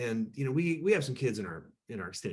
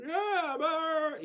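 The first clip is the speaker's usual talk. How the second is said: much higher voiced objection; father-in-law pushing on. Father-in-law refusing to drop the topic, imitated voice. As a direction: keep pressing like the older man. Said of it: "he kept".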